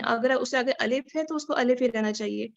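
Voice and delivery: normal talking voice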